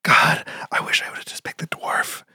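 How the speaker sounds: regretful whispered aside. Retold or imitated. Player envying the dwarf pick, regretful whisper. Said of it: "it makes me like".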